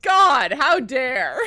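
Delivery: mock offended cry.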